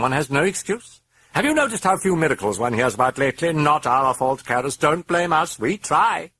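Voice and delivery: high, loud, mocking demon voice; gloating demonic sermon; sardonic demon. A narrator's reading. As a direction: lecture the priest with relish. Demon lectures Karras.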